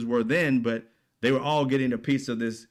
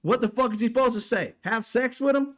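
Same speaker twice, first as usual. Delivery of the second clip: raised, higher, exasperated; heated rant; 7 semitones higher than usual. Real, not acted. Ranting to viewers about US meddling, angry and raised. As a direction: hammer the outrage home.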